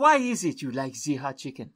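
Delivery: put-on French accent. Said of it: "that was pretty good French accent".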